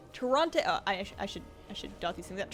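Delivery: ordinary talk, nothing marked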